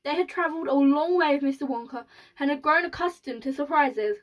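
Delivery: plain reading voice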